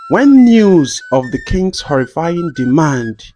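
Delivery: ordinary delivery, nothing marked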